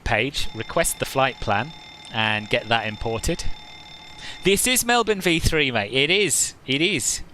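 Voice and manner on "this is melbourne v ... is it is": cheeky Aussie bloke, pitched up; mock Australian accent. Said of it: "offended by that Australian accent".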